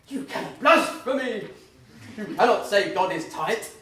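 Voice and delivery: thundering, high-pitched scolding, drawn out; mock outraged rebuke. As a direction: shout it like a horrified zealot. Acts stern rebuke of kid.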